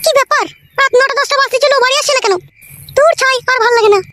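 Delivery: put-on high-pitched, fretting young wife voice; worried daughter-in-law. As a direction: put on a high wifely voice, waiting up anxiously.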